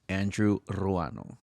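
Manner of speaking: Andrew Ruano said slowly, rolled R in Ruano; English Andrew, then Spanish Ruano; correct name pronunciation. Shown as a demonstration. Going round saying names correctly, Andrew Ruano pronounced carefully. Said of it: "say our names correctly".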